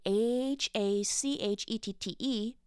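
usual speaking voice

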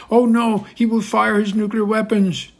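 mocking panicky leaders. Putin mocking frightened Western leaders, shrill panic. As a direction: ham up scared leaders pleading. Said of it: "run around in circles crying".

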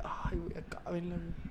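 hushed whisper at mass. Retold or imitated. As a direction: whisper it under your breath. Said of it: "whispered complaint at mass".

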